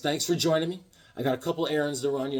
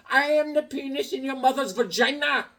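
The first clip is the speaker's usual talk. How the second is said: high silly comeback; Bobby voice; 10 semitones higher than usual. Retold or imitated. Mimicking Bobby's crude reply. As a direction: camp it up high. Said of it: "He goes".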